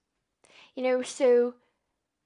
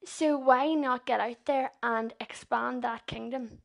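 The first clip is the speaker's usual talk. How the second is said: usual speaking voice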